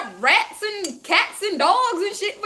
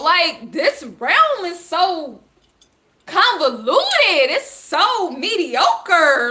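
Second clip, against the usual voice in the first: drawn out; 4 semitones lower, much slower than usual. Real, not acted. Fed-up drawn-out complaint.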